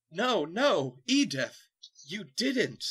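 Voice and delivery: high, loud cry of disbelief; character recoils in disbelief; horrified character. Acted. Heroes confront the dark lord, high alarmed cry.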